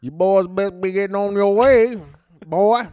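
loud, slow Southern sheriff drawl; Southern cop. Pretend. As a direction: run them out of town, Southern cop. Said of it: "a Southern cop".

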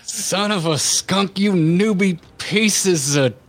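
put-on old prospector; slow, high cranky cussing; Yosemite Sam. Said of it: "You've gone, like, full, um, Yosemite Sam on us".

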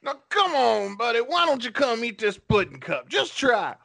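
Bill Cosby impression. Pressing the pudding cup on a buddy, high and coaxing. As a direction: coax the buddy like a creepy uncle. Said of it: "my Bill Cosby impression".

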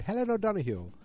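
high, lilting fake Irish; hammed-up, high-pitched mock Irish brogue; terrible Irish accent. Shown as a demonstration. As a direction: put on a bad Irish accent. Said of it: "I'm doing terrible accents".

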